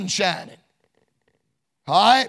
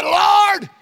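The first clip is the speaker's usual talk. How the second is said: calling out; 8 semitones higher, 4 dB louder than usual. Retold or imitated. Thief calls to Christ.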